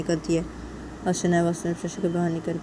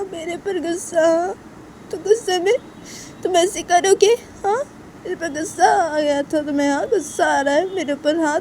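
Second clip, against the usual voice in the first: higher, louder insistent reproach; angry, hurt Shanaya; 10 semitones higher, 6 dB louder than usual. Acted. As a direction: push the friend away with wounded accusations. Voices Shanaya pushing Tisha away. (नाराज़, आहत आवाज़ में बार-बार उलाहना)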